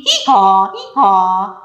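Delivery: playful hee-haw bray; donkey bray. Acted. Donkey noise in picture book.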